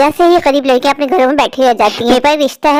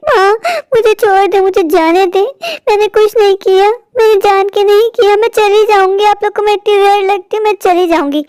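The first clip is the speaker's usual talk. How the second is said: high, wailing protest; tearful pleading; 7 semitones higher than usual. Acted. Scolded stepdaughter begs to be let go, tearful pleading. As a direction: plead helplessly, promising to go.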